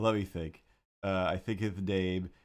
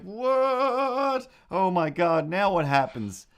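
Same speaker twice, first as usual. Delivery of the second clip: mock shock; 9 semitones higher, 6 dB louder, much slower than usual. Retold shocked reader reaction.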